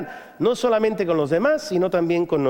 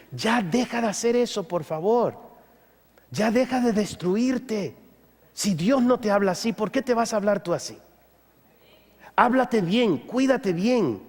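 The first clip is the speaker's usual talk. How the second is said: tender pleading. Acted. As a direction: plead gently, like comforting someone hurting.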